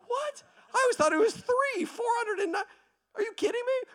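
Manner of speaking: very high-pitched disbelief; stunned, comic high protest; incredulous squeal. Acted. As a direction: squeak out your shock at the number. Tax collector stunned by the sum.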